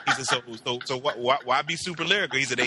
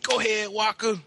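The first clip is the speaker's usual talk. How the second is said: cheering him on; 7 semitones higher than usual. Retold or imitated. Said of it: "I said".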